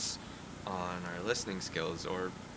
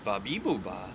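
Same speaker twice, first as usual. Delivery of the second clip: floppy jaw ya ya drill; 8 dB louder, slower than usual. Acted. Gibberish babble with voiceover translation.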